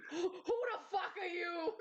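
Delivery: high, drawn-out mock disbelief; hammed-up shock. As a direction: stretch it out in exaggerated high-pitched shock. Echoes the shocked Goku quote.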